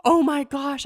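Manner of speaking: high, loud burst of surprise; excited exclamation. Retold or imitated. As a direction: blurt it out in shock. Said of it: "And she's like".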